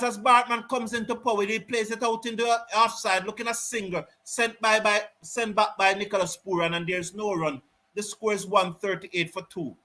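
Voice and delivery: calm live cricket commentary; play-by-play. Real, not acted. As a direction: call the delivery as it happens.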